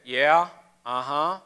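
low-key, uninterested store clerk; dismissive shopkeeper. Acted. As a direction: politely send them off, unconvinced. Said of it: "he probably just went".

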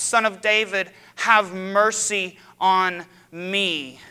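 loud, slow, drawn-out plea; crying out for mercy. Retold or imitated. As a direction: call out desperately from the roadside. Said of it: "he begins to cry out".

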